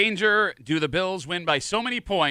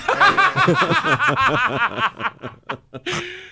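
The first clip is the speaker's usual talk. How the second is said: big burst of real laughter; loud high laugh; 12 semitones higher, 5 dB louder than usual. Real, not acted. Big laugh at sweats joke.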